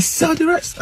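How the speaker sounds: high, sharp angry repeat of the order; upset demand. Retold or imitated. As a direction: snap it at the cheating attendant. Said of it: "I was so upset".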